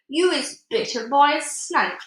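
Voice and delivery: loud, slow warning; shouted. A narrator's reading. BFG tricking the giant, loud alarmed warning. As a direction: sound the alarm with weight. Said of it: "he shouted".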